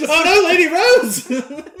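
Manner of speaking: high, loud, drawn-out mock dismay; mock horrified cry. Guards finding Lady Rose crushed, hammed-up horror.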